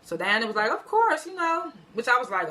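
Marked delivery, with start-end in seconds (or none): 0.7-1.7 high, eager-to-help "Of course"; willing agreement. Retold or imitated. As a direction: agree readily, "Of course" pitched well up. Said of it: "She wasn't down for it".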